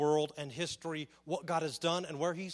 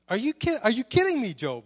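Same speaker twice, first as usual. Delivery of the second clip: higher and louder, sarcastic "are you kidding me"; mock-exasperated God, louder and higher; sarcastic exasperation; 7 semitones higher, 9 dB louder than usual. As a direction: push the disbelief up, half-shouting. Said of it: "the sarcasm in here".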